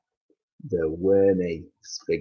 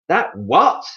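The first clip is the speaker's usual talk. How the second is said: high, loud alarmed question; scream; 9 semitones higher, 6 dB louder than usual. Acted. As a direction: shriek the question, higher and louder. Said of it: "screamed Missus Twit".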